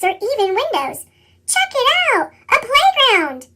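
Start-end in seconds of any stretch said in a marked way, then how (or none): 1.5-3.5 high, loud delighted cry; excited kids. Acted. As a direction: point at it in wonder.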